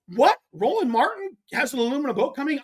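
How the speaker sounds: high-pitched incredulous echo of bad news; reenacted stunned reaction; retold disbelief. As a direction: repeat it back, voice jumping up in disbelief. Retold shocked reaction to rival news.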